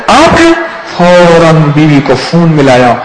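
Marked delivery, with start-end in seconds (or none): 0.1-0.5 high, astonished "is it really you?"; surprised recognition. Retold or imitated. The man meets the preacher, high and astonished. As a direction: gasp at meeting someone famous. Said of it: "کہنے".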